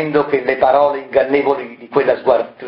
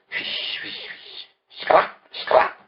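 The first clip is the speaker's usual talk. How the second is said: voiceless scratchy chalk noises, barely breathed; whispered chalk scratching; 6 dB quieter, much slower than usual. Acted. Variconi mocking his colleagues with chalk scratching noises. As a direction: scrape out the sound of chalk on stone. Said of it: "Variconi replicò con un sorriso malevolo".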